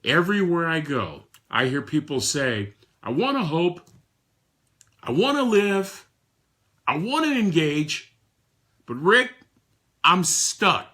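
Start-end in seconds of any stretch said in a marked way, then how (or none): 3.0-10.9 voicing someone begging for help to hope; pleading stuck person. People everywhere telling Rick they're stuck, pleading.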